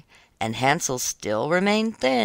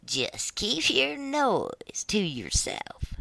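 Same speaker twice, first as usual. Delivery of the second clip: put-on slow, higher sneering old woman; a hint of a dismissive witch; scornful witch; 7 semitones higher, slower than usual. A narrator's reading. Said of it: "said the old woman".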